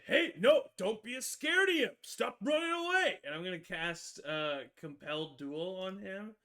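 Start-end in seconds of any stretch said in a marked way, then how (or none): 0.1-3.2 high, loud yell at a fleeing imp; in-character shout. Acted. Paladin yelling at the fleeing imp, high loud shout. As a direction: bellow at him to stop running. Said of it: "and I'm gonna say".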